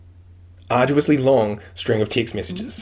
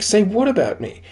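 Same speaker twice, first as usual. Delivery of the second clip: incredulous retort; 10 semitones higher, 3 dB louder than usual. Retold or imitated. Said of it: "I'm like".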